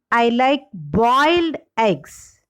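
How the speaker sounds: boiled stretched and stressed. Shown as a demonstration. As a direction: lean hard on the word boiled. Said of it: "the shift in the primary stress".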